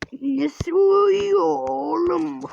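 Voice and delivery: very slow, stretched in another voice; drawn-out mock voice. Shown as a demonstration. Player mocking the sleeping kid, stretched out slowly. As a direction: stretch every word out in a funny voice.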